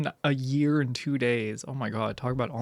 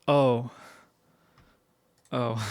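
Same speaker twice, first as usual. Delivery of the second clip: ordinary talk, nothing marked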